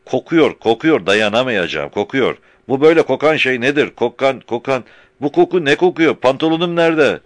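loud high bellowing. A narrator's reading. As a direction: bellow in disgust. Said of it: "bas bas bağırmaya başladı".

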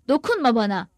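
ordinary delivery

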